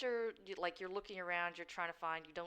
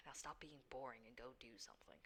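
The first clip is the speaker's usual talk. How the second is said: low, soft, bored shadow sigh; dark whisper behind you; 6 semitones lower, 15 dB quieter than usual. Acted. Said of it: "it's the Shadow's voice".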